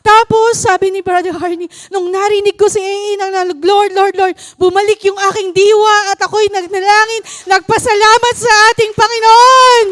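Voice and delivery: high, loud preacher's fervour; loud, high, full-force sermon delivery; fervent preaching. Acted. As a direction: preach it to the congregation with fire.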